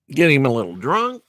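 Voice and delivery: plain reading voice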